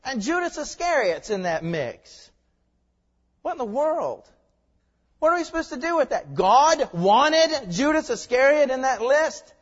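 high, loud disbelief; incredulous protest. Acted. Objector asking how God could want Judas, incredulous. Acts out baffled, outraged objector.

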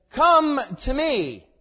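loud, high, slow proclamation; drawn-out, forceful royal command. Acted. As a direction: summon them as a king would, at full voice. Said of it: "He doesn't make it a suggestion".

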